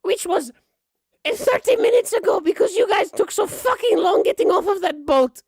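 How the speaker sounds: very high, loud granny complaining; high-pitched cantankerous grandma voice; cranky old grandma. Acted. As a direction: lay down the law as a tired, tetchy old woman. Game master plays cranky grandma.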